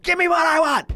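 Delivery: loud, high exasperated demand; frustrated shout. Real, not acted. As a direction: yell it like a fed-up fan. Demanding what he wants from WWE.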